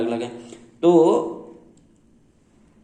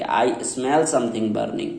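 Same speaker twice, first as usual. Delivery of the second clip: slow correct example; 2 semitones lower, 3 dB quieter, much slower than usual. Shown as a demonstration. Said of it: "आपको क्या बोलना पड़ेगा".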